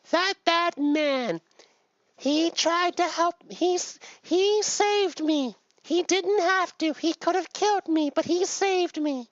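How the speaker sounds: very high, slow falsetto creature voice; little spider. Acted. Spider telling Buddha of the bad man, slow high falsetto. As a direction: plead the case in a tiny, drawn-out squeak. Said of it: "and the spider says".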